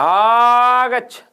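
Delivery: one character calling another across the stage; loud long call. Shown as a demonstration. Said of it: "तेज आवाज देकर के बुलाना". (एक पात्र का दूसरे को ज़ोर से लंबी आवाज़ देकर बुलाना)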